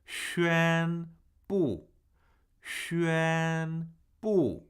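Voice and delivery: separated syllables, long level 宣 and falling 布; tone demonstration. Shown as a demonstration. Teacher breaking down "to declare", 宣布 level then falling. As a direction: hold 宣 steady, pause, then drop 布. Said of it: "First tone, fourth tone".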